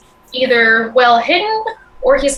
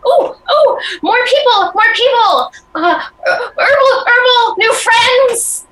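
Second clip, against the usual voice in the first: high, loud, laughing cry of more people and new friends; excited commoner; 11 semitones higher, 3 dB louder than usual. Acted. Villager to the party, shrieking with glee. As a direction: squeal it out like an overjoyed villager spotting newcomers.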